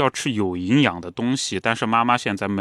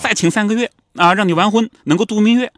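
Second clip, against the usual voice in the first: eager mother; 10 semitones higher, 5 dB louder than usual. Retold or imitated. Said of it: "老娘就说啊".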